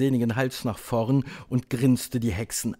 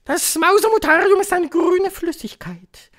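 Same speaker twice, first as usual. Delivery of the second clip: very high, loud witch voice; piercing high proclamation; commanding Grand High Witch; 6 dB louder than usual. A narrator's reading. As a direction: shout it as a domineering witch leader.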